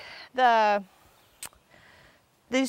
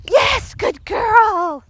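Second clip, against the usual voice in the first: drawn-out praise; 9 semitones higher, 6 dB louder, much slower than usual. Real, not acted. Praising dog after jump.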